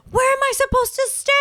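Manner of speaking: high, loud imitated passenger whine; stranded passengers. Mimicking stranded passengers, high indignant whine. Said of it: "all these people were like".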